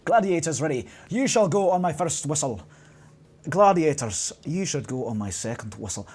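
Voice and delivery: booming Scottish game-show referee; Scottish accent. Acted. Host readying quiz gladiators, mock Scottish accent. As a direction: call contestants to order in a rough attempt at Scottish. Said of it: "not very good today with the accents".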